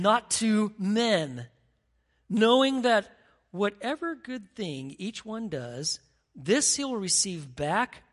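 slow, measured reading of the verse; scripture reading. Acted. Preacher reading verse seven to the church, slow and solemn. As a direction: read the text with weight.

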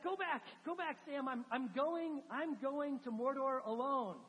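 loud, high call ending slow; mock yell, dragged out at the end; yelling back. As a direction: shout him back to shore. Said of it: "Frodo yells back".

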